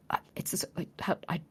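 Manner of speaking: an unsure, unvoiced whisper; nervous whisper. Whispering as she nears the soothsayer, unsure. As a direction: whisper while not knowing what to say.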